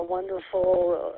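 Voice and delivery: usual speaking voice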